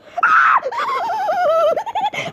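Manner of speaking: high, loud screaming laugh; shrieking laugh. Real, not acted. Gamer cracking up at the grandpa character, shrieking with laughter. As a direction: burst into a shrill shrieking laugh.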